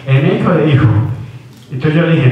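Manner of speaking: ordinary talk, nothing marked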